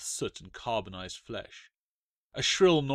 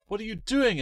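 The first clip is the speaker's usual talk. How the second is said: high, loud shocked cry; a girl crying out at the custodian; cried out; 12 semitones higher, 5 dB louder than usual. Acted. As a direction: shout at him in dismay. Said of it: "Alice cried".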